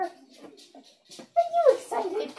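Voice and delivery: high, very slow sing-song question. Real, not acted. Owner coos slowly to her excited dog. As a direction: stretch each word sweetly.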